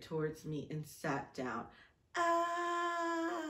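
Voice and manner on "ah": high laughing squeal; embarrassed squeal. Real, not acted. Reading her diary to a friend, giddy high squeal.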